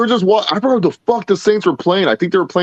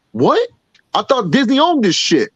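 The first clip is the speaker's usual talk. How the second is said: imitating a friend caught off guard, pitched up; retold disbelief; 9 semitones higher than usual. Friend mistaking the patch for Lando, mock disbelief. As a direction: jump up high on the question.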